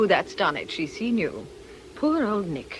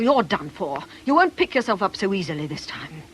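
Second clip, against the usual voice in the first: usual speaking voice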